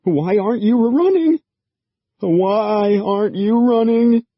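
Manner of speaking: high, loud panicked plea; panicked cry at the creatures; desperate panic. A narrator's reading. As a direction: beg them to flee in terror. Kelly panics before the creatures.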